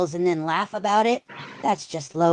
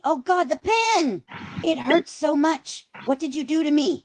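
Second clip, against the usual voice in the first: agonized cry; 9 semitones higher than usual. Acted. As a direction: cry out in agony. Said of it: "Screams in agony".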